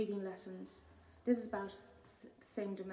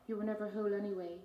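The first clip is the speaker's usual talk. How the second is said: ordinary talk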